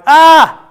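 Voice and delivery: short, loud high yell of "Ah"; mock shout. Shown as a demonstration. Preacher showing what the shouting wasn't like, mock shout. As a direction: give a sudden high-pitched demo holler. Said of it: "the shouting wasn't like".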